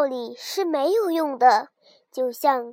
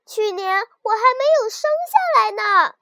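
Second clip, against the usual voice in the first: higher, louder, frightened little lamb; a small lamb crying out to the wolf; pitiful cry; 8 semitones higher, 7 dB louder, faster than usual. A narrator's reading. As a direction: plead for your life, voice up high. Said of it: "可怜的小羊喊道".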